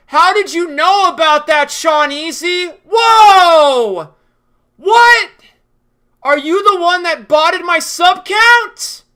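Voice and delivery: high, loud, shocked whiny voice; over-the-top shocked YouTuber voice; mocking Cyberboy. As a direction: ham up his fake surprise. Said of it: "he'll be like".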